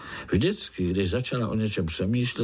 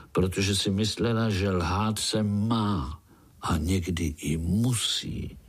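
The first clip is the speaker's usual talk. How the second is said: plain reading voice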